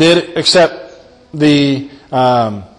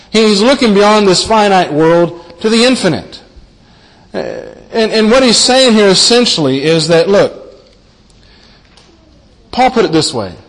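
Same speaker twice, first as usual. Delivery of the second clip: ordinary talk, nothing marked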